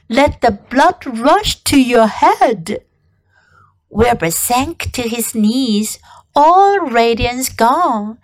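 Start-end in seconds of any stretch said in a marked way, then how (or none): none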